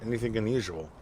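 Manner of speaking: usual speaking voice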